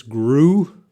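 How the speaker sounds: "Grew" said slowly and clearly, one syllable with a long oo; pronunciation demo. Shown as a demonstration. Teacher modelling "Grew" for students, clear and slow. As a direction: model "Grew" for the class, rising at the end. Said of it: "How to pronounce this?".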